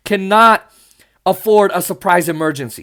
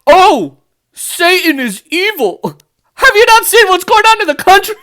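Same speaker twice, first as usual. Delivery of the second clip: high, put-on pious alarm, much louder; mocking conservative voice; 11 semitones higher, 4 dB louder than usual. Vlogger mocking conservatives in a high scandalized voice. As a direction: ham up a scandalized churchgoer.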